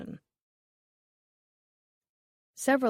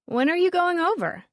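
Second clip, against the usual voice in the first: eager; 10 semitones higher, 4 dB louder than usual. A narrator's reading. Molly eager, tipsy question.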